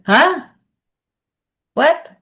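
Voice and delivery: high-pitched, baffled questions with a gap between; flustered. Retold or imitated. Director on a call, asked how to help, flustered. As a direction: react as if thrown completely off. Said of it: "he was so flustered".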